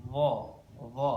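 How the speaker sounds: "Dha" voiced, with no escaping air; heavy, voiced th in "Dha"; breath held. Shown as a demonstration. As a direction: hold the breath back on "Dha". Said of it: "nafasnya ditahan".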